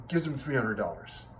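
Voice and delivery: normal talking voice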